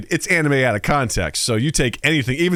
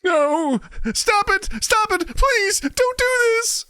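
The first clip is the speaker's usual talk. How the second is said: very high falsetto begging; hammed-up squeaky victim begging for mercy; panicked pleading; slower than usual. Captive vampire begs the sorcerer to stop, panicked.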